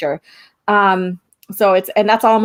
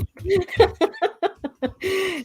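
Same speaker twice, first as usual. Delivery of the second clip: high giggle; 10 semitones higher, 5 dB quieter than usual. Real, not acted. Joking about April Fools.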